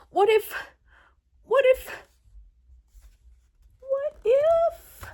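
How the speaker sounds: rising worry. Acted. Worried, rising each time.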